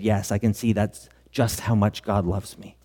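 ordinary talk

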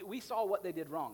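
normal talking voice

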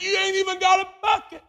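high, loud sassy comeback; indignant retort. Acted. Woman retorts to Jesus.

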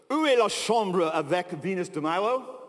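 loud, careful attempt at a French accent; best French accent. Wife asks a Louvre attendant the way, in her best French accent. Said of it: "with her best French accent".

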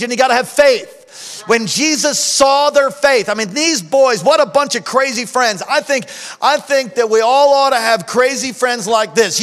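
loud, high revival preaching; full-force preaching. Acted. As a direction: preach it out to the congregation.

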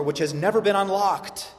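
usual speaking voice

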